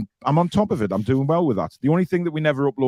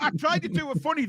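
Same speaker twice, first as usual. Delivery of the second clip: mocking echo; 13 semitones higher, 5 dB quieter than usual.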